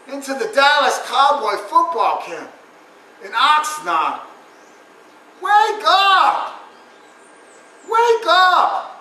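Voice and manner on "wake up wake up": high, loud barked command; shouting wake up. Acted. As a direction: jolt them awake. Yelling at audience to wake.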